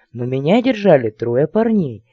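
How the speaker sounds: usual speaking voice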